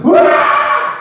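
high, loud unvoiced wail; child's tantrum scream, high and loud; mock meltdown wail. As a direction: burst out like a child melting down. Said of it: "it goes from zero to".

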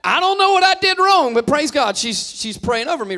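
overhearing wife's prayer, mock pleased and high; mock grateful self. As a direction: play it like you think you're blessed. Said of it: "And I'm like".

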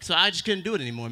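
normal talking voice

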